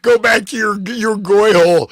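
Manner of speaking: loud mocking yell; mock shout. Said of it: "he's like".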